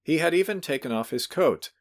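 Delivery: ordinary delivery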